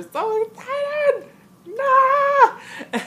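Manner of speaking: high squeal into a loud shriek; mock hysterical squeal; screaming excitement. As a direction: burst into fan screaming. Acting out screaming excited crowd.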